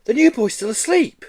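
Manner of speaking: high gleeful tattle. Acted. Girl gleefully pointing out the sleeping boy. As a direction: relish telling on him. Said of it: "said a tall girl gleefully".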